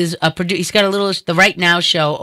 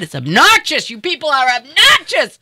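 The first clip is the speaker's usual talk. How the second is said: exasperated rant; 8 semitones higher than usual. Retold or imitated. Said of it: "And then I'm like".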